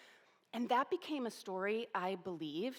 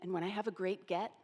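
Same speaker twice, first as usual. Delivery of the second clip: ordinary talk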